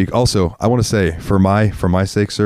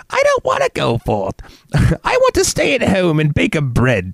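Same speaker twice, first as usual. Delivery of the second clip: much higher, homebody character voice; silly put-on voice; 10 semitones higher than usual. Showing off a fun voice, much higher silly character. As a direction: play a timid creature who won't leave home. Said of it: "I like doing all kinds of voices".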